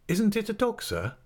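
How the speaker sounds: put-on high-pitched, deferential young pupil voice; polite schoolboy. A narrator's reading. Boy questions the schoolmaster.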